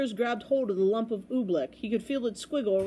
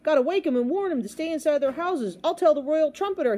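higher, louder, urgent shout; 7 semitones higher, 4 dB louder than usual. A narrator's reading. Boy resolves to warn people, shouting. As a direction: shout it as you rush off. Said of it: "he shouted".

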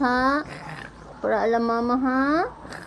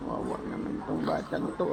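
soft pitying whisper, much lower; whispered aside; 12 semitones lower, 9 dB quieter than usual. Real, not acted. Mother pitying her crying child, whispered aside.

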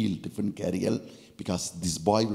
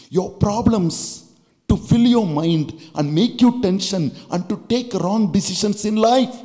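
louder, higher, pressing preaching; rising to a climax; 8 semitones higher, 7 dB louder than usual. Acted. Preacher challenging the congregation, building loud and high. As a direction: drive the question home with fire.

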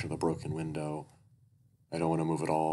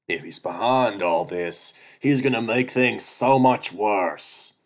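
screeching weasel; 5 semitones higher, 9 dB louder, slower than usual. Acted. Duke threatens the captive bear.

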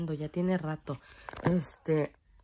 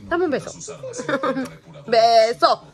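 high, loud coaxing with laughter; playful baby talk; 5 semitones higher, 8 dB louder, slower than usual. Acted. Mother coaxing her baby daughter for a kiss, high playful baby talk. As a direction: beg the baby for a kiss, giggling.

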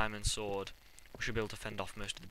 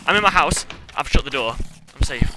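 relieved gush; 5 semitones higher, 16 dB louder than usual. Real, not acted. Relief after escape.

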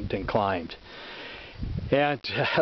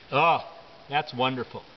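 ordinary delivery

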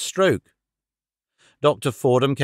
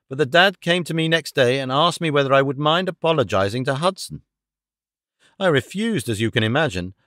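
plain reading voice

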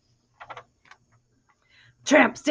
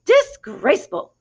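slow, disapproving hen voice, a touch higher; tut-tut like a fussy hen; prim hen; 5 semitones higher, 6 dB louder than usual. A narrator's reading. First hen sniffs at the pigs, mildly voiced. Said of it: "said the first hen".

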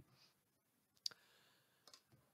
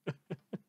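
a soft chuckle under the breath; short laugh; 2 semitones higher, 6 dB quieter than usual. Real, not acted. Host to listeners, chuckling at the jawless villain. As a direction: let a quiet laugh slip out.

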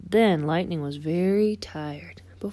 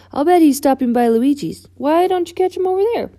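high, loud, urgent suggestion; pitched up and pushed, speeding up at the end; quick-thinking race car character; 8 semitones higher, 9 dB louder than usual. Acted. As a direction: blurt out a quick diversion. Voices Lightning thinking fast.

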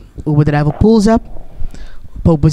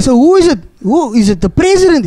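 pitched-up, eager questions from a cop; curious cop; 7 semitones higher than usual. Retold or imitated. A traffic cop asking his colleague who is in the car, eager and higher. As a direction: press him to know who it is, much higher.

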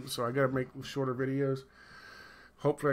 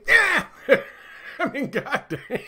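laughing; 12 semitones higher, 8 dB louder than usual. Real, not acted. Laughing at his own bit.

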